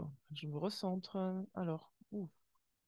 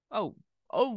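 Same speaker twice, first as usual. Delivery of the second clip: loud high startled gasp of oh; 6 semitones higher, 8 dB louder than usual. Real, not acted. Tarot reader reacting to a harsh card, startled loud. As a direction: react as if the card struck you.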